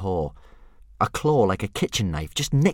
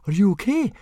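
put-on boy's voice; 12 semitones higher, 5 dB louder than usual. Fishlegs asks, voiced.

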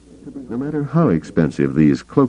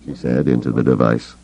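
usual speaking voice

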